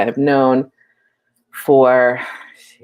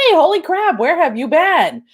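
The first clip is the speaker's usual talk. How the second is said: excited; 12 semitones higher than usual. Real, not acted. Greeting a longtime viewer in the live chat: excited squeal. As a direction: squeal of delight, much higher.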